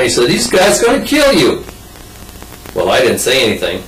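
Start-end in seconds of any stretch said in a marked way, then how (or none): 0.3-1.6 high-pitched imitated Georgia girl; Georgia accent. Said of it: "a Georgia accent".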